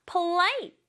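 "polite" leaning on the second syllable; stress on LITE. Shown as a demonstration. Teacher confirms correct pronunciation for pupils, "polite" stressed on LITE. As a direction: put the weight on "lite" in "polite". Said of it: "요렇게 polite라고 발음해야지".

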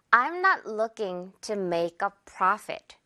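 very slow, stress on not; slow model sentence. Shown as a demonstration. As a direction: spell out the sentence slowly. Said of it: "이런 말 한번 해볼게요".

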